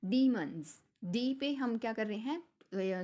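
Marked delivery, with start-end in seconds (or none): 0.1-0.8 demons with a loud long डी; stress on de. Shown as a demonstration. Teacher modelling demons with a loud stressed डी. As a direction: punch the डी of demons. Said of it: "stress देके बोल रहे हैं".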